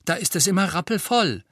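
high, emphatic retort; defiant justification. A narrator's reading. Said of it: "sagte Hermine trotzig".

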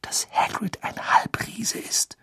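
breathy whispered question; whispering. Acted. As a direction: whisper so nobody else hears. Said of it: "wisperte er".

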